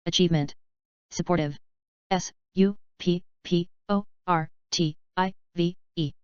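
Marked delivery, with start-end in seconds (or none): 2.1-6.1 slow, letter by letter; unhurried classroom spelling. Shown as a demonstration. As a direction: spell each letter out slowly. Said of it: "spelling drill, slow then fast".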